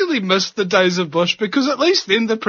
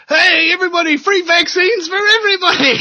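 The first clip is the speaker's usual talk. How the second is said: mock announcer shout, higher and louder; crowd hype shout; 10 semitones higher, 4 dB louder than usual. Someone in the crowd hyping free vaccines, loud mock shout. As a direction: call out to the whole crowd, hyped and high. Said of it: "somebody goes".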